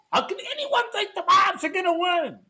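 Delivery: ordinary delivery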